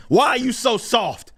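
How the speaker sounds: slow high sneer; mock troll. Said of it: "was like".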